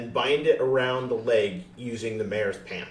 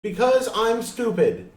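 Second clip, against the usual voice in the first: high, loud self-deprecating shout; shouted sarcastic confession; mock yell; 13 semitones higher, 7 dB louder than usual. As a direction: yell it as a joke on yourself. Player yelling self-mockery.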